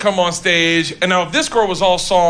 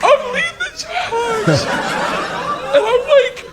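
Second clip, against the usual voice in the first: very high, strained exclaiming; hyped-up rallying. Acted. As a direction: work yourself up as leader of the charge. Drunken rallying, mocking his own zeal.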